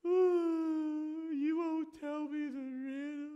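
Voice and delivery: high, loud whiny wail; mock sobbing plea, high and loud; fake crying. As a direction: turn on the crocodile tears to get the secret. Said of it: "gets the big tears".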